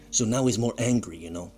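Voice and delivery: ordinary talk, nothing marked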